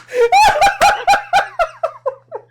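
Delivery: loud, very high shriek of laughter; real piercing laugh-scream. Real, not acted. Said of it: "That scream".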